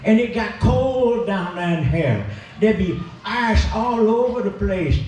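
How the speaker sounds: ordinary talk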